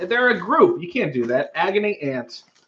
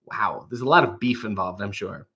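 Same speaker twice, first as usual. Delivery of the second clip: unvoiced whisper, dry and sarcastic; whispered aside; 6 semitones lower, 7 dB quieter than usual. Real, not acted.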